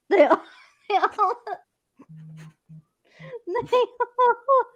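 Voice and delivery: laughing too hard to finish, high-pitched. Real, not acted. Groomer telling friend about the dogs' voices, laughing. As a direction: break up giggling and restart the sentence. Breaks up laughing mid-sentence.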